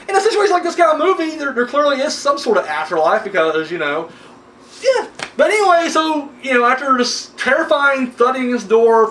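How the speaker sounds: ordinary talk, nothing marked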